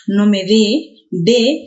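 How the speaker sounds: slow, drawn-out, emphatic "dé"; teacher hammering the stressed "dé". Shown as a demonstration. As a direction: stretch and push the word "dé". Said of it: "que es tónico".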